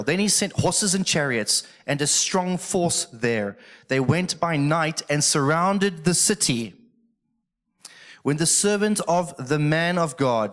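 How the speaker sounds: slow, measured proclamation of the passage; scripture reading. Real, not acted. Preacher reading the Bible story to the congregation, slow proclamation. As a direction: read it out grandly and deliberately.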